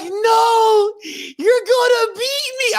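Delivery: put-on high-pitched drawn-out kid wail; hammed-up tearful howl; mock crying. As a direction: bawl it in comic protest.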